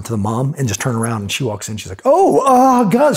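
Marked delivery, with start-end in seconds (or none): 2.0-3.2 loud, high-pitched startled mom; acted nervous gasp of words, high and loud; nervous startle. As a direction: imitate a nervous mom caught off guard. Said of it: "She's, like, totally nervous".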